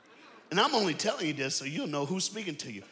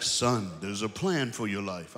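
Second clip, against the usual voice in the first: lower, measured fatherly tone; dad's solemn voice; 8 semitones lower, slower than usual. Retold or imitated. Dad sitting his son down for a talk, solemn low voice. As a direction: imitate a serious father. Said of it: "My dad was like".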